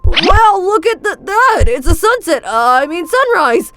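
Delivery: high, loud, fake excitement; change the subject in a panic; flustered dodge. [name] tries to distract from the sleep talk, loud and flustered.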